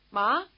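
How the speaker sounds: "Ma" lifting at the end like a question; rising question. Shown as a demonstration. Teacher illustrating asking for mother, rising question on "Ma". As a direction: ask "Ma" with rising intonation. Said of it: "with a rising intonation".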